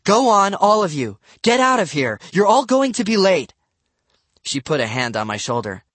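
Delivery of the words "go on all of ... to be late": high, loud, hurrying mother; exasperated shouting. A narrator's reading. Mom chases the kids off to school, shouting. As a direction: shoo them all out loudly. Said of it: "she cried, covering her ears".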